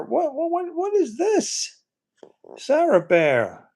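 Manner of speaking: squeaky high disbelief, drawn out slow; genuinely thrown, voice jumping way up; real shock in a high pitched voice. Real, not acted. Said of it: "I'm very shocked".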